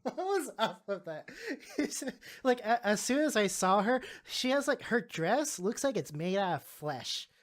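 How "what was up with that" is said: high, loud, laughing disbelief. Real, not acted. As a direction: ask it through real laughter. Laughing over the witch scene.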